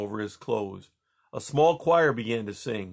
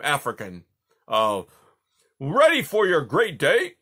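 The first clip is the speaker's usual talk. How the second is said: loud, high, hearty royal summons; booming jovial Tsar; 5 semitones higher, 6 dB louder than usual. Acted. Tsar rounding up servants for church, booming. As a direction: rouse the whole court cheerfully.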